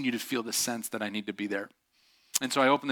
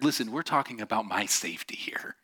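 hushed joking whisper; 2 semitones lower, 4 dB quieter than usual. Real, not acted. Preacher whispering his excuse to the crowd, hushed whisper. Joking aside about own safety.

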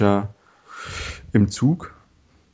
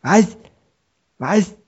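high shouting into phone; to someone hard of hearing; 12 semitones higher, slower than usual. Retold or imitated. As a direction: shout like the line is bad. Acted phone caller shouting.

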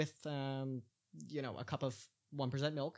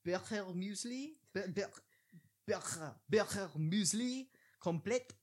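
slow, high, mock German pronunciation; bad German accent; 5 semitones higher than usual. Host mangling a Swiss dish name in a bad German accent. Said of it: "That was pretty bad".

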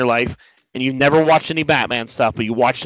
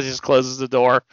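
ordinary delivery

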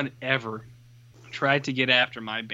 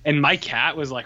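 usual speaking voice

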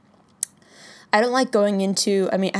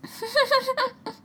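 high squeaky laugh burst; cartoon laugh. Real, not acted. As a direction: let out a cartoonish giggle. Reacting to movie line.

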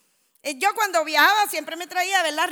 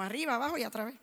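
ordinary talk, nothing marked

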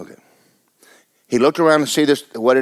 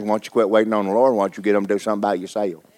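normal talking voice

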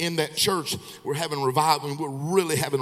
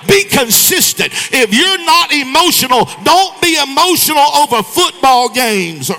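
much higher and louder, shouted sermon climb; preaching at full force; 8 semitones higher, 10 dB louder than usual. Acted. Preacher rebuking emotional churchgoers, preaching at full force. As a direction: hammer it home to the church.

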